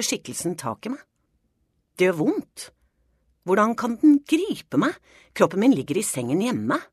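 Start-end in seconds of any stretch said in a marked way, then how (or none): 1.9-6.8 higher, rising in panicked disbelief; urgent, pitched-up protest; alarmed. A narrator's reading. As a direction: narrate it gripped by sudden fright. Gripped by shadow figure in nightmare.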